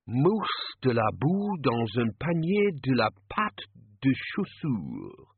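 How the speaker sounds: slow, showy French menu-speak; mock French. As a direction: roll it out like a fancy menu. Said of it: "Mud mousse in a basket of shoe pastry".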